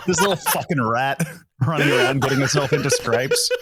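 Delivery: ordinary talk, nothing marked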